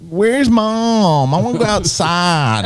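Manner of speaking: put-on high-pitched, drawling Southern child; Southern accent. Dad mimicking his child asking for Mom, Southern accent. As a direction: imitate a little Southern kid asking. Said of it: "my three-year-old now has a Southern accent".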